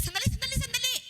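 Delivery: high urgent call of sandali; calling out to stop someone. Acted. Elijah calling the running widow back, urgent and high. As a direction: call after her to wait, pitched high.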